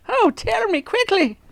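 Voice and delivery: very high urgent plea; wife crying out. A narrator's reading. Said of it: "she cried".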